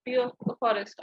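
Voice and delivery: ordinary delivery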